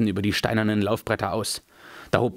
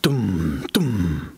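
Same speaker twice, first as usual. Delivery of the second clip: low, heavy drum-like boom; booming drumbeat; 3 semitones lower than usual. A narrator's reading. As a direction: let each word thud like a distant drum. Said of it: "dröhnten die Trommelschläge".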